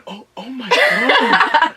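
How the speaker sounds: slow, high, laughing oh my God; a shocked cry played for laughs; shocked gasp. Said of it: "I just hear an honest".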